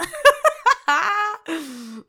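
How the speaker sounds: a burst of high, loud laughter. Real, not acted. Laughing at her own stool sample story. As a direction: crack up at the popsicle stick. Laughing about popsicle stick.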